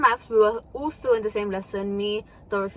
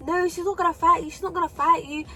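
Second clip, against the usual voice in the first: high-pitched imitation of school staff; acted high-pitched reassurance; dismissive staff member; 7 semitones higher than usual. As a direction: mimic a teacher brushing off worries. Staff member dismissing the worry, retold.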